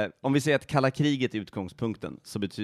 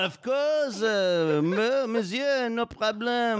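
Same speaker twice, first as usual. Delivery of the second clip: much higher, playful French waiter voice; 11 semitones higher, much slower than usual. Pretend. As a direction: chirp it like an eager French waiter. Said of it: "And they're like".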